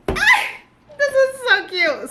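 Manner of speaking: very high, loud, slow squeal of delight. Real, not acted. Reader spotting a favourite quote, squealing high. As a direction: squeal like a fangirl over a cute quote.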